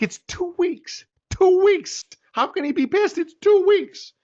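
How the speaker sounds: high, incredulous panic; it's only two weeks, high and panicky; panicked disbelief. Retold or imitated. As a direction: voice jumping up in alarm.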